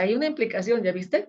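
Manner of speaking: usual speaking voice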